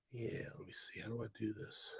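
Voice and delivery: barely audible whispered aside; very soft, whispery self-talk; muttering to oneself. Real, not acted. Muttering to himself while working controls.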